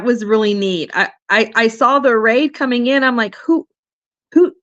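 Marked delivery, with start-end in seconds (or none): none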